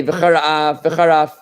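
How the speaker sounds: "v'charaf" said as a single word, no break; run together. Shown as a demonstration. Teacher showing the wrong way: one blended word. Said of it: "it's like one word".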